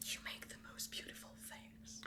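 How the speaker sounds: hushed, breathy whisper; very soft whispered admiration; whispered compliment. Acted. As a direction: whisper the praise to the shopkeeper.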